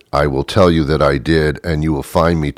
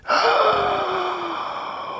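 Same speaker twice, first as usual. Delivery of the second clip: long, high drawn-in gasp; excited gasp; 9 dB quieter than usual. Acted. Couple thrilled about their date night, excited gasp. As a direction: gasp in hammed-up anticipation.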